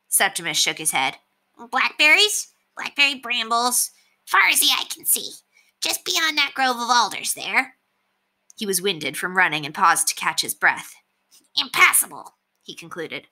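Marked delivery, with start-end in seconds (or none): 1.6-7.8 lightly voiced rat, higher and out of breath; a rat gasping out bad news; winded rat. Septimus reports brambles to Brendan, higher winded rat voice. 11.6-12.4 lightly voiced rat, higher and out of breath; a rat gasping out bad news; winded rat. Septimus reports brambles to Brendan, higher winded rat voice.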